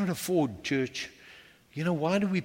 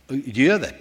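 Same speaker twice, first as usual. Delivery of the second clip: ordinary talk, nothing marked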